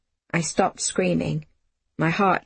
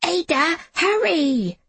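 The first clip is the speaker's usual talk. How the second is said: high-pitched urgent shout; a boy's high, frantic call; 10 semitones higher, slower than usual. A narrator's reading. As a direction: call out in alarm to hurry. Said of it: "Jamie shouted".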